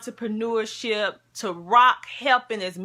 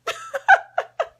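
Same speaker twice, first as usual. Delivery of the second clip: high, squealing giggle; embarrassed laugh; 6 dB louder than usual. Real, not acted. Vlogger laughing at crying on camera. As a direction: laugh through the tears.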